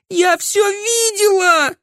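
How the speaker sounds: very high, drawn-out hysterical scream; shrieking. A narrator's reading. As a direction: shriek it in horror. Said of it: "завизжала Камилла". (истошный визг)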